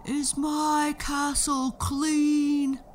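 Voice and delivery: slow, loud, high giant voice; giant yelling. A narrator's reading. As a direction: bellow it like a fussy giant. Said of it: "the giant would yell".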